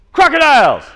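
high, loud game call; called-out command. Real, not acted. Coach calling out jungle game cues to kids, loud high shout. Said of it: "I'm gonna call out".

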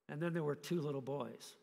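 ordinary talk

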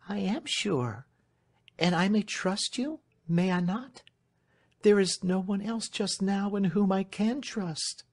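high, pleading voice; Marian, agitated young lady. Acted. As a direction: appeal anxiously to a trusted servant, high-pitched.

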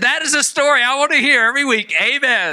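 high, delighted mock-preacher cheer; enthusiastic approval. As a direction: proclaim it high with gusto. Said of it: "I said".

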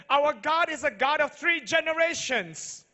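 loud, high preaching cry building to the names; preaching at full force. Acted. Pastor booming to the congregation, full-force preaching. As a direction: proclaim it to the whole hall.

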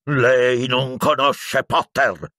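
slow, high, strained screech; furious shriek. A narrator's reading. Professor arguing with the minister, shrieking. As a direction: screech each word out drawn long. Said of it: "strillò Piton".

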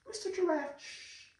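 ordinary delivery

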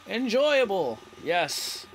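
ordinary talk

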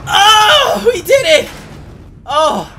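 high, loud relieved cheer; triumphant shout. Real, not acted. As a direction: yell in disbelieving victory. Escaped the monster.

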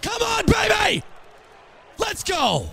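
high, slow, shouted cheer; will the play home at the top of your voice; excited shout. Real, not acted. Cheering a near touchdown.

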